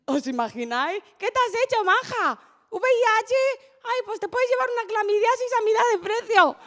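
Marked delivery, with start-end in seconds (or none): 1.2-6.6 high-pitched, gushing sales patter; hammed-up, high-voiced chatty upsell; pushy shop assistant. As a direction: act like a saleswoman pushing a bargain. Acts clinic upselling like a shop.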